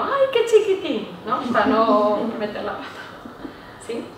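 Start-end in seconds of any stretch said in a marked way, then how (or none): 0.0-1.1 high, slow tender coo; drawn-out sweet baby coo. Shown as a demonstration. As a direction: coo at an ugly baby kindly. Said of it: "siempre digo".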